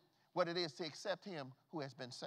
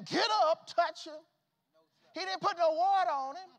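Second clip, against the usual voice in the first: loud, high preaching cry; preaching at full force; 10 semitones higher, 8 dB louder than usual. Acted. Preacher rousing the church about Lazarus, shouting. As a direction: whoop it to the congregation.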